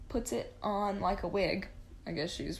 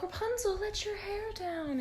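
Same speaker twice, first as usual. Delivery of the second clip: mock prince voice; 12 semitones higher than usual. In her nightmare, the prince calls to Rapunzel, mock-romantic and high. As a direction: ham up the dreamy prince calling up to the tower.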